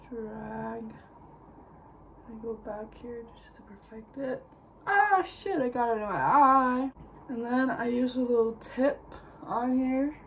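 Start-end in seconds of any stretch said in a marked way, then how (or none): 4.9-6.9 loud, high yelp of annoyance; pained outburst. Real, not acted. Vlogger pokes her eye mid-makeup, pained outburst. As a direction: cry out at a sudden mishap.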